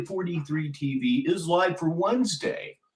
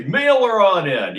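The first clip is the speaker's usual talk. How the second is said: higher, louder call of Mail her on in; hammed-up sign-off; 5 semitones higher, 8 dB louder than usual. Shown as a demonstration. Host repeating his donation call, louder. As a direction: belt Mail her on in like a retro ad. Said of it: "That's what I'm gonna say".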